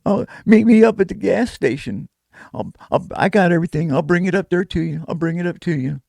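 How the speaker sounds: high woman's voice, hint of crying. Woman calling back after a beating, high and tearful. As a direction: pitch up, a touch tearful and hurried.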